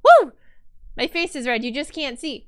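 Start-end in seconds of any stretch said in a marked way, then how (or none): 0.0-0.3 loud, high cheer; excited whoop. Real, not acted. Streamer cheers at chat's follows, a loud high whoop. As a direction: let out a joyful whoop.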